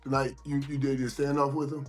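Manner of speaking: normal talking voice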